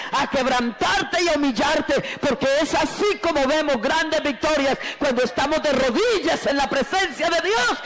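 high-pitched, driving revival preacher; fervent sermon building to a long, high aleluya; full-force preaching. Acted. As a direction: preach without letting up, climbing to ecstatic praise.

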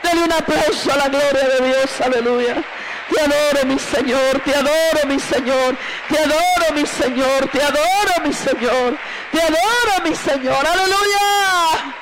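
high, impassioned sermon building to praise; fervent high cries, last word held long; preaching at full force. Real, not acted. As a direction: drive the congregation up to a hallelujah.

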